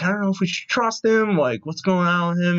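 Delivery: high-pitched, wary questioning; doubtful viewer. Retold or imitated. Viewer wondering whether to trust his character, high and doubtful.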